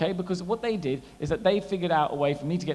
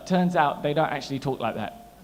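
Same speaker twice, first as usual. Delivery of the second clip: usual speaking voice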